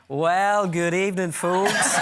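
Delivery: a hint of a chirpy sports show host; Patrick Keeley impression. Impressionist greets Patrick Keeley himself, as Patrick Keeley. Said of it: "Patrick on Patrick".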